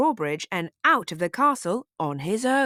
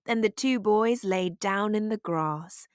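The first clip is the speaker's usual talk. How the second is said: plain reading voice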